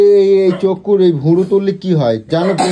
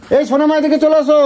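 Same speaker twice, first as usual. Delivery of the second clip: calling out; 6 semitones higher than usual. Real, not acted. Calling the child over.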